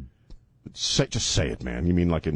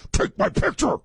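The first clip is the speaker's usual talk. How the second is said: high bossy shout. Acted. Acting the fiancée.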